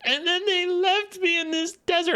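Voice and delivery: high, whiny mock sob of a teen; mock her whining in a high, nasal-free falsetto; mock whiny Ciri. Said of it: "bawling and whining about her parents".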